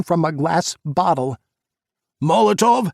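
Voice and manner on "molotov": high, loud roared warning; roaring. A narrator's reading. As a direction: roar it out in alarm. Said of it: "Adam roared".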